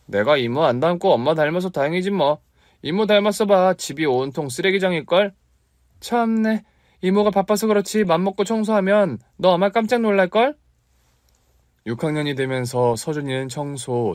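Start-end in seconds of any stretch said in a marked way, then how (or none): 0.1-5.3 put-on higher, cheeky child voice; teasing kid. Boy teases aunt. 6.0-10.6 mock indignant. Aunt huffs at teasing.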